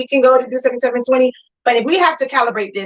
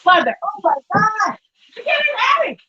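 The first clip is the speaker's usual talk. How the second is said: straining, pitched way up; strained high exclaim; 8 semitones higher than usual. Real, not acted. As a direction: squeal it out under the weight. Lifting heavy press, exclaiming.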